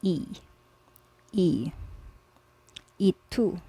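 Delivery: Y said as a short E; Spanish y as and. Shown as a demonstration. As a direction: repeat the E sound clearly. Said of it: "then we pronounce Y as".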